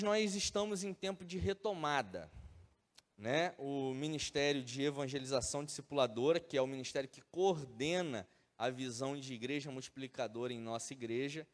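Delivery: ordinary talk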